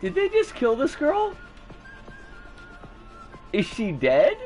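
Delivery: ordinary talk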